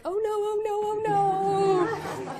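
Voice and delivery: high, laughing oh-no panic; playful panicked whimper, high and giggly; mock panic. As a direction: squeak it out like you've just lost the ship. Ship sliding off ridge, panic.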